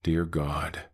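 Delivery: voiced, empty echo of a frightened prayer; hollow copied voice. The creature mimics Eli's words in a hollow, empty voice. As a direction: copy the words perfectly but with nothing behind them. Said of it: "But hollow, empty, wrong".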